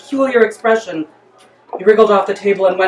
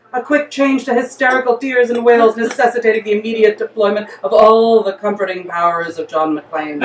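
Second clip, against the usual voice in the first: ordinary delivery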